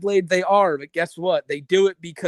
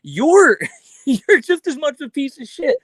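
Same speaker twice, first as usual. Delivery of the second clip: louder, higher, laughing outrage; exasperated rant; 9 semitones higher, 6 dB louder than usual. Real, not acted. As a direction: vent it, half laughing. Ranting at Blade.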